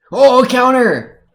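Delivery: high, loud, drawn-out cheer; excited shout. Real, not acted. Viewer reacting to a counter in the fight, excited shout. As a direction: yell it like a fight spectator.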